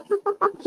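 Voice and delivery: short giggle at him; light teasing laugh. Acted. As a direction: laugh at the scared gangster. Tae laughs at scared mafia.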